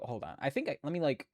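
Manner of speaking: normal talking voice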